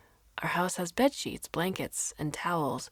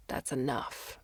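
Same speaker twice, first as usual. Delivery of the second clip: low firm; 4 semitones lower, 7 dB quieter, slower than usual. A narrator's reading. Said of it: "he says".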